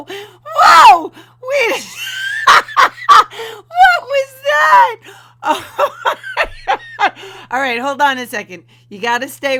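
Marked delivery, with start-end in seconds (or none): 0.0-7.5 high, loud shrieks of laughter; cracking up in disbelief; shrieking laughter. Real, not acted. Host watching the clip shrieks with laughter.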